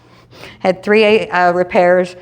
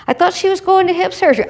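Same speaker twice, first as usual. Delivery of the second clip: high gossipy crowd; 11 semitones higher than usual. Retold or imitated. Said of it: "everybody's going".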